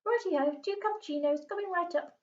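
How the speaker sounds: Mister Stink. A narrator's reading. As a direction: sing it out like a jolly waiter.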